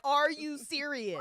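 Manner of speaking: slow, high, loud incredulous drawl; exasperated disbelief. Retold or imitated. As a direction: stretch it out in exasperation. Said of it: "You're like".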